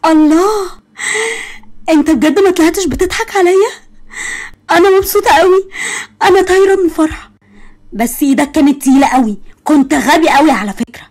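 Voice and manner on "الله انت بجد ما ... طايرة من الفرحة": high, bright burst of joy, almost in tears; overjoyed. Acted. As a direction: squeal with delight. Overjoyed at being helped. (فرحة طاغية بصوت عالي)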